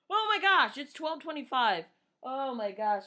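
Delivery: sudden loud high gasp of alarm; startled panic. Real, not acted. Realizing she's late for work, loud and high in alarm. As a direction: blurt it out in real alarm.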